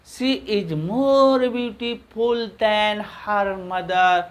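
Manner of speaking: very slow, drawn-out model sentence; slow model reading. Shown as a demonstration. Teacher reading the finished example to students, slow and drawn-out. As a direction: stretch each word out for learners.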